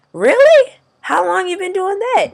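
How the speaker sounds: very high, hammed-up astonishment; imitating amazed coworker. Coworker hearing about the needle, imitated in high-pitched amazement.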